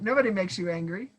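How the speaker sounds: ordinary talk, nothing marked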